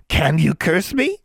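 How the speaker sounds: high, slow mocking witch; taunting hag. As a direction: tease her prey slowly.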